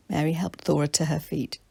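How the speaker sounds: ordinary delivery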